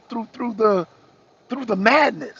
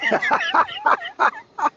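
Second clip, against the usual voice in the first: high wheezing laugh. Real, not acted. Laughing at his rants.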